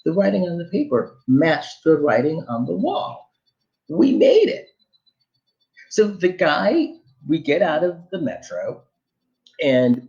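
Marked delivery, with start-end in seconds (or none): 3.9-4.7 a high, loud burst of relief; triumphant yelp of relief; relieved joy. Retold or imitated. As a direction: cry out that you made it. Relief at reaching the right stop.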